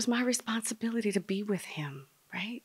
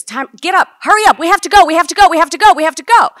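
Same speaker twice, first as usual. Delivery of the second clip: high, urgent repeated yelling; frantic screaming; 11 semitones higher, 21 dB louder, much faster than usual. Retold or imitated. Daughter rushing her best friend out, screaming. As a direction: scream at them to get moving. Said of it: "I'm screaming at her".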